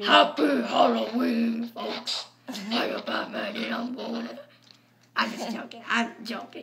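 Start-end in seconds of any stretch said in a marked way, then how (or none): 0.0-4.4 prank voice. Pretend. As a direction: play a spooky Batman through the changer. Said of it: "I would pull this".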